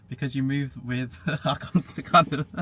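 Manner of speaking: breaking into laughter; words dissolving into giggles; laughing. Real, not acted. Cracks up mid-sentence.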